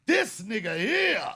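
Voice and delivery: loud, slow, drawn-out brush-off; dismissive callout. Retold or imitated. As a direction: stretch it out loud to shut someone down. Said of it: "get somebody off your back by saying".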